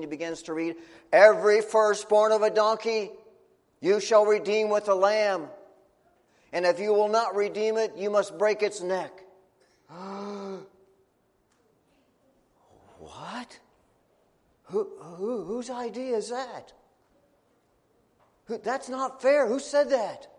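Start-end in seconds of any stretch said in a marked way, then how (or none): 1.1-9.2 solemn proclamation. Acted. Priest reads the scroll to the family, loud and solemn. 9.9-10.6 a sharp gasp of horror; shocked gasp. Acted. The family gasps at the priest's verdict. 13.0-16.7 stunned disbelief. Acted. 18.5-20.3 high and loud, outraged; indignant protest. Acted. The family protests to the priest, high and outraged.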